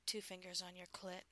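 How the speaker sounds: ordinary delivery, nothing marked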